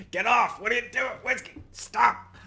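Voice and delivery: a flustered dad pushing the dog away, hammed up; imitating dad scolding. Dad on the call yelling at Whiskey to get off, mock flustered.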